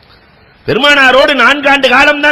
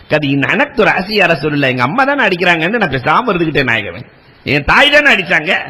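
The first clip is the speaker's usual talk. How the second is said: humble tearful reply; 6 semitones lower than usual. Acted. Abu Huraira tells the Prophet he stayed silent, low and meek. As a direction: lower voice, resigned and hurt.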